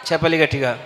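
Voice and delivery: ordinary talk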